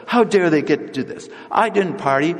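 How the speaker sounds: put-on high, resentful older brother griping; indignant complaint. Older brother resenting the people who come and party, high indignant gripe. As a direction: whine about the newcomers who only party.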